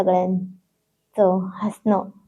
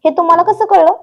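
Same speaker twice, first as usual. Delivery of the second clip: high, loud startled gasp of a question; wife startled that he guessed where she is; 9 semitones higher, 7 dB louder, faster than usual. A narrator's reading. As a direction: caught out, jolt up in surprise.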